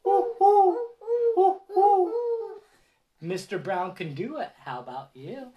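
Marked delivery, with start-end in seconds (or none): 0.0-2.1 high loud owl hoots. Acted. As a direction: hoot like an owl. Owl sound in book.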